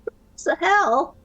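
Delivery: put-on high, frustrated young woman; upset girl voice. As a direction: sputter it out, distressed. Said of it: "She tries again".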